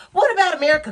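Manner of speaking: indignant outburst. Real, not acted. Angry rant at the congresswoman.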